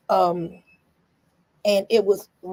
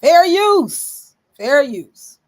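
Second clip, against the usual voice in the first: much higher, louder shout; loud for the back room; 7 semitones higher, 9 dB louder, much slower than usual. Shown as a demonstration. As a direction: call it out to the back row. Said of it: "For the back room".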